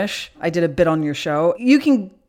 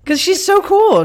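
excited; 14 semitones higher, 7 dB louder than usual. Real, not acted. Gushing about her friend.

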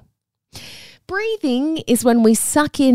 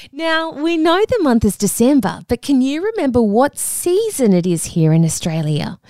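usual speaking voice

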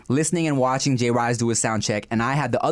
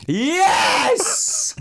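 excited shout. Real, not acted. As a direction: yell it out, thrilled. Hyped for the slap challenge.

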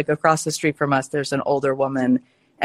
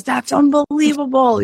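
much higher, a little louder, marvelling; amazed grandma; 11 semitones higher, 3 dB louder than usual. Retold or imitated. Grandma, amazed by her granddaughter's success, squeals with wonder.

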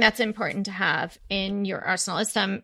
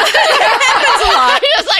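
laughing; 8 dB louder, slower than usual. Real, not acted. Laughing while telling.

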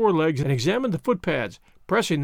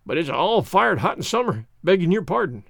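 plain reading voice

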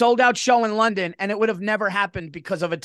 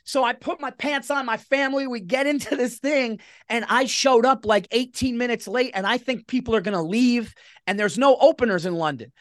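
normal talking voice

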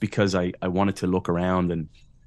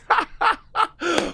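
a high, breathy burst of real laughter; short laugh. Real, not acted. To the host after the coffee shop story, real laugh.

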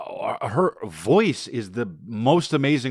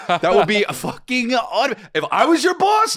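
mock outraged boss; 10 semitones higher, slower than usual. Playing a boss outraged at a raise request, high slow shout. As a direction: bellow it like a furious boss hearing the request.

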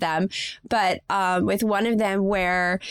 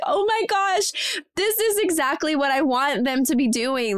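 joyful surprise; 6 semitones higher than usual. Retold or imitated. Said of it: "makes me teary because I'm like".

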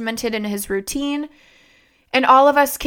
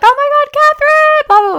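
much higher, louder fangirl squeal; excited squeal; 8 dB louder than usual. Retold or imitated. Passing fans recognize her and squeal her name, high and loud. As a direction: squeal it like a thrilled fan. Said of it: "girls had recognized me and were like".

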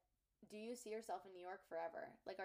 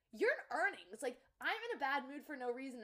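louder, higher mock exasperation; exasperated retort; 5 semitones higher, 6 dB louder than usual. Girlfriend retells challenging her cheerful boyfriend, exasperated. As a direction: retell it as a baffled, punchy complaint.

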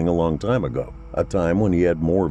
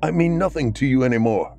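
bitter raised shouting; angry rant; 6 semitones higher than usual. Acted. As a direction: rail at her through the door. Raging at wife behind door.

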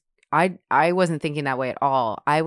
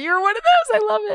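much higher, louder delighted squeal; excited squeal; 5 dB louder than usual. Real, not acted. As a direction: gush with sudden glee. Delighted by prank call confession.

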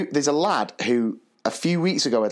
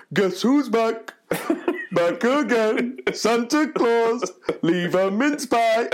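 slow, high, chanted Santa delivery; hammed-up Santa rapping in slow motion, half laughing; Father Christmas voice; 9 semitones higher, much slower than usual. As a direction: chant it out in a jolly, drawn-out Santa voice. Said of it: "he turned around and went".

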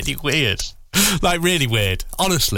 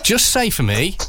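ordinary delivery

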